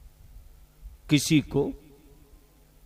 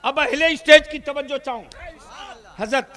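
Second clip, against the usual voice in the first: normal talking voice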